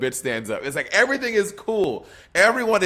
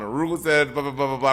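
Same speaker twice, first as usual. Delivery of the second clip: usual speaking voice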